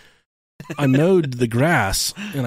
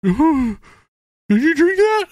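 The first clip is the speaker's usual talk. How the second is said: much higher, louder mom voice; mom's voice; 5 dB louder than usual. Retold or imitated. Mom asking her son if he drank it, high and loud. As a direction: play the alarmed mother. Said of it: "She's like".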